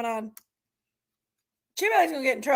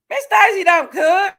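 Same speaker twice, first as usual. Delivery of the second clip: louder, playful jab at Miss Daisy; loud teasing call-out; 4 semitones higher, 8 dB louder than usual. Real, not acted. Host teasing Miss Daisy to the chat, loud playful call-out. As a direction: call it out loud to tease her.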